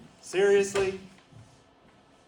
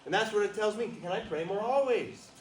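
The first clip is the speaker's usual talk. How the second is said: fervent preaching; 7 semitones higher than usual. Acted. Preaching continues.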